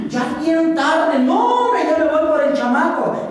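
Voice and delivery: loud, sing-song panic, slow at first; suddenly realizing it's late, blurt it out high and loud; hammed-up alarm at the clock; alarmed exclaim. Self panicking about lost time.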